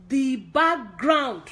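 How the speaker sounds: BACKGROUND said slowly and loudly; emphatic. Real, not acted. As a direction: spell out "background" with slow, loud force. Exasperated plea about filming backgrounds.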